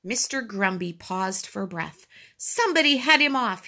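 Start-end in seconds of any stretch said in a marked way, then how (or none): 2.4-3.7 high, urgent order; raised-pitch command; directing. A narrator's reading. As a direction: call out an order to bystanders. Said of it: "he directed".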